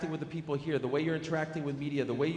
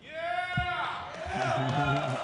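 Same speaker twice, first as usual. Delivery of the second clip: excited whoop; 11 semitones higher than usual. Real, not acted. Host hypes fighter's answer.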